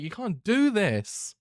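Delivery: high, slow, drawn-out cry of protest; anguished shout. Real, not acted. Viewer begging the show not to kill the hero, slow anguished shout. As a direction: stretch it out like a wounded plea.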